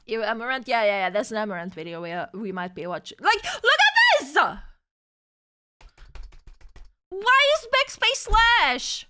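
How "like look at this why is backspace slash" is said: much higher, louder cry of disbelief; slow, stretched outrage on the last words; outraged disbelief. Real, not acted. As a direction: shriek at the broken keyboard. Keyboard typing wrong keys.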